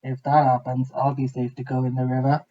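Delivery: low grunting pig voice; wild pig grunting with hope. A narrator's reading. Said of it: "grunted a wild pig".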